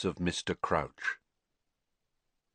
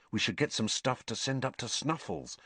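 softer muttered aside; 3 semitones higher, 6 dB quieter than usual. Acted. Harry mutters to his friends, softer. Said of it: "Harry muttered".

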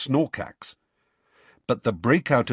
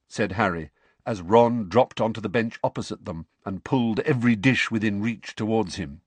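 ordinary delivery, nothing marked

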